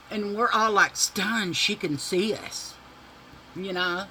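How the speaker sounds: usual speaking voice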